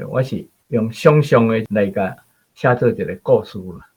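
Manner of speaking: ordinary talk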